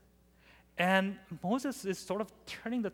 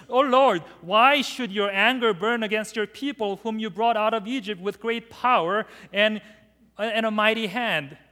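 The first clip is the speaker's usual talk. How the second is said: pleading Moses; 4 semitones higher, 8 dB louder than usual. Acted. Preacher voicing Moses pleading.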